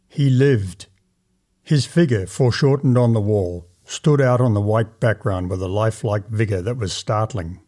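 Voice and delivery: usual speaking voice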